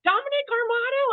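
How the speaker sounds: high, loud call of a name across a crowd; calling out across the airport. Retold or imitated. Stranger in airport calling out to husband, loud call. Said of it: "this guy yells out".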